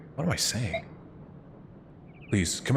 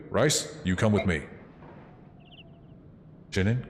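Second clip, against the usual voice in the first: ordinary talk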